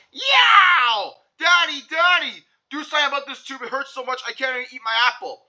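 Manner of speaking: high yowl, then fast pleading; child yelling in pain. Acted. Andrew to his father about his tooth, high pained yell. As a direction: cry out for daddy. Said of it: "and yelled".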